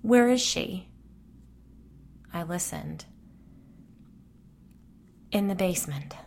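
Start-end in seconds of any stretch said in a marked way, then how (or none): 0.0-0.9 higher, louder choked question; tearful friend demanding an answer; fighting back sobs. Acted. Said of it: "Colleen was fighting back sobs". 5.3-6.2 ghostly whisper. Acted.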